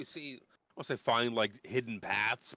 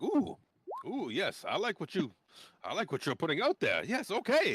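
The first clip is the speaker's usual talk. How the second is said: put-on much higher, eager old fellow squeal; Dalamar voice; 9 semitones higher, 3 dB louder than usual. Game master voicing Dalamar to the party, high delighted squeal. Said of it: "Dalamar's like".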